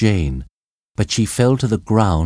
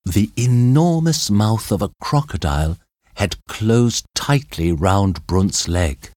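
usual speaking voice

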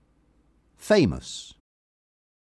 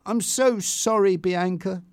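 higher, earnest apology; remorseful apology; 7 semitones higher than usual. A narrator's reading. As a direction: plead for forgiveness. Tanya apologises to crying Bianca.

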